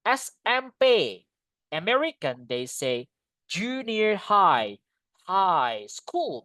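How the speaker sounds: normal talking voice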